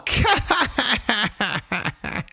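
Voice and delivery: high, mocking fake cackle; fake laugh. Drunk wife laughing at her husband, fake and mocking. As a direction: force out a long derisive laugh. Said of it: "She's doing this fake laugh".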